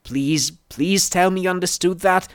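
high, urgent plea; anxious pleading. A narrator's reading. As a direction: beg for reassurance. Desperate request after dazed instructions.

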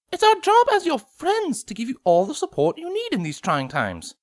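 high refined lady voice; Rarity voice. Acted.

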